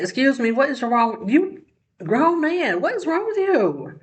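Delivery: high-pitched, outraged telling-off; indignant scolding. Acted. Commentator telling off a grown man in an imagined cafeteria spat, indignant.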